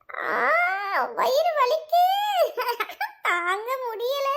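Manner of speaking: giggling through a put-on wail; fake stomach pain. Friend faking a stomach ache to fool Poomari, laughing through the fake pain. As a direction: drag out mock agony, breaking into laughter. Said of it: "உன்னோட நடிப்பை start பண்ணு".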